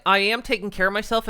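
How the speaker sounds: ordinary talk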